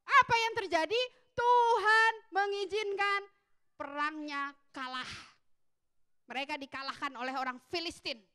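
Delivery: ordinary talk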